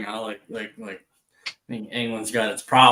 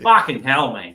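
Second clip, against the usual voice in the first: Australian "Fucking hell, mate", louder, leaning on "Fucking"; Aussie fucking hell; 7 dB louder than usual. Shown as a demonstration. Contrasting Aussie swearing for American viewers, Australian accent. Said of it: "The Aussies will be like".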